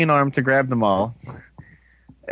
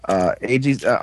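normal talking voice

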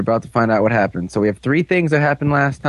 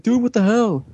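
mock outrage; 9 semitones higher than usual. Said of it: "And he's like".

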